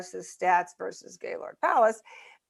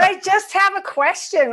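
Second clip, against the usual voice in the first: retold outburst, high-pitched and loud; mock-angry insistence, pitched way up; mad, passionate; 11 semitones higher, 6 dB louder than usual. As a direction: imitate him worked up and insistent. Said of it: "I thought he was mad".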